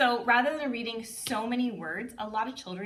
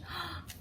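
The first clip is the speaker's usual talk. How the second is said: surprised gasp; 10 semitones higher, 8 dB quieter than usual. Shown as a demonstration. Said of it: "then we turn the page".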